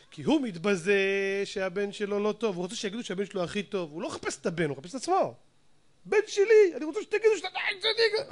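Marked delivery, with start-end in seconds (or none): 6.1-8.3 imitating a vain father, higher and faster; a father boasting in a high, puffed-up voice; mock proud father. Preacher mimics a father who wants praise, high and quick. Father seeking his own honour.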